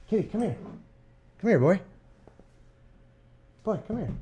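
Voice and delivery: high coaxing call to the cat; high pet-calling voice. Real, not acted. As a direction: coax the cat over, high and soft. Calling his cat over.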